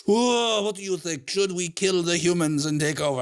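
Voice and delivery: hammed-up French cat voice; mock French accent. Professor imagines cats plotting over cigarettes, mock French accent. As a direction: play a scheming cat with a silly French accent. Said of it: "I don't know why my cats are French".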